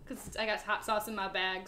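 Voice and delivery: ordinary talk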